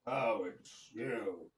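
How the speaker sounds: low, slow gruff giant. Acted. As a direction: rumble it slowly like a cyclops. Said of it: "you hear a gruff voice say".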